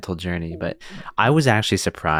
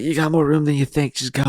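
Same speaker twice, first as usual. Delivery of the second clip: imitating Oz; 7 semitones higher than usual. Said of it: "Oz is like".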